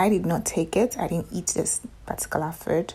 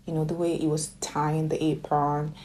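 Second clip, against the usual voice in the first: ordinary delivery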